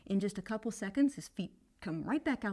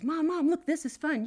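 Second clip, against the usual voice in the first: put-on higher, louder excited little boy; child calling mom; 8 semitones higher, 8 dB louder than usual. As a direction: show off to mom. Boy calls from the top.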